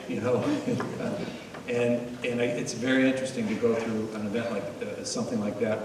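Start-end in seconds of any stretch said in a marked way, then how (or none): 0.5-1.6 quick high breathy chuckle; airy laugh. Real, not acted. Speaker to the audience, a short laugh. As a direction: laugh it off lightly. Laugh after rhetorical question.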